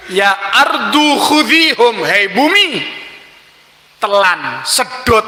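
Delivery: slow, solemn prophet's command; commanding the ground to swallow; Musa commanding the earth. Acted.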